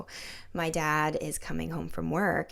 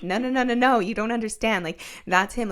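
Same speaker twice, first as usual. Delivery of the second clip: a raised, pressing no, no, no; insistent protest; 5 semitones higher, 6 dB louder than usual. Retold or imitated. To her sister who says stop it, insistent. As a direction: push back hard against the doubt.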